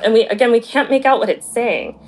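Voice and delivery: normal talking voice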